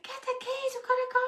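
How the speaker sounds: high, breathy panicked call; a whispered half-scream, pitched very high; reliving the panic without full volume; retold scream. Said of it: "I'm, like, screaming".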